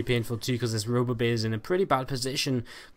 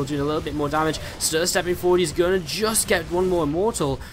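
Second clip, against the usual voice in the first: excited; 3 semitones higher than usual. Real, not acted. Big fight in live casting.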